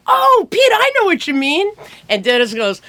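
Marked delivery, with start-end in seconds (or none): 0.1-1.7 high, loud burst of understanding; imitated eager realisation. Songwriter to producer, high mock realisation. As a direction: suddenly get it, pitched way up. Dennis's sudden understanding acted.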